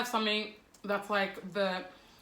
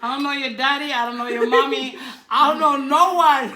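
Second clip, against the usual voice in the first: laughing mock rant; 6 semitones higher, 11 dB louder than usual. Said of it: "retelling her reply".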